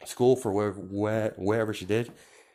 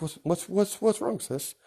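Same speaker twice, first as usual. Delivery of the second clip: worried brother; 10 semitones higher than usual. Acted. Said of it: "And Midoriya's like".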